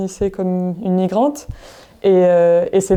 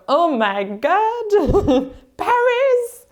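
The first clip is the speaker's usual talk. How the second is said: mock over-the-top English-speaking excitement, with a laugh; high, stretched-out squeal of delight, playfully imitated; gushing Australian friends; 12 semitones higher, much slower than usual. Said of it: "tous mes amis d'Australie, c'était genre".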